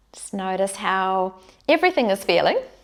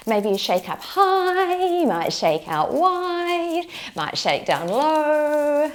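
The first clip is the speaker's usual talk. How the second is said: clinking bangles; 6 semitones higher, slower than usual. Real, not acted. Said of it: "Probably hear my bracelets jingling there".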